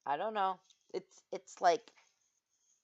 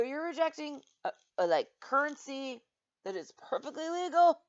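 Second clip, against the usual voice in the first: higher, incredulous retold argument; raised-pitch indignation; indignant protest; 6 semitones higher, 4 dB louder than usual. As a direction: argue it with mock outrage.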